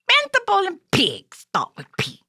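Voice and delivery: loud high little creature; high puppet voice. Acted. Puppet telling host about P words, high and loud. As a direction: chirp it out loudly like a puppet.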